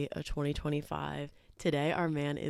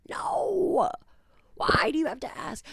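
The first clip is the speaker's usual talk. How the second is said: incredulous; 11 semitones higher, 5 dB louder than usual. Retold or imitated. Quoting her reply to guy.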